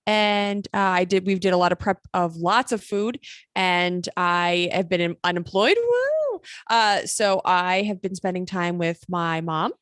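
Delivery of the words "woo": excited whoop. Real, not acted. Celebrating being unemployed.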